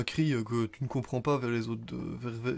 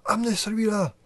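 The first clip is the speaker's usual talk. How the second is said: high, louder call to the men; called-out cry; 9 semitones higher, 5 dB louder than usual. Acted. Garde crie aux siens d'amener un nain, cri aigu. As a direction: shout an order across the group. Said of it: "il fait un cri".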